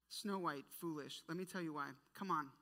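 ordinary talk

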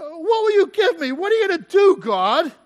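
high, loud demand to God; exasperated questioning. Acted. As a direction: challenge God, pitch pushed high. Acted questioning of God by Abram.

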